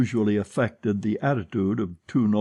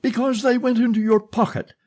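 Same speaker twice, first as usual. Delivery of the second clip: gasped; 12 semitones higher, 4 dB louder than usual. Acted. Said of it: "gasped the old woman".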